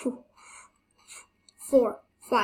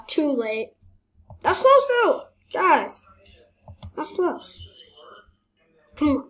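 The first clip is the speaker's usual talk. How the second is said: ordinary talk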